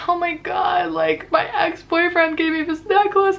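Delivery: put-on high, wailing drunk sob-talk; retelling herself bawling over the lost necklace; drunk crying. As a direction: wail it out through tears, pitched high. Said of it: "I'm just, like, crying and crying".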